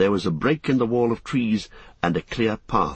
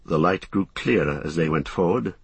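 ordinary delivery

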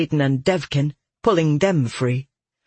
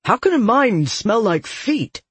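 higher, indignant protest; 7 semitones higher than usual. A narrator's reading. Soldier insulted by voice in his mind, indignant. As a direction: protest in offended disbelief. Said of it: "he growled".

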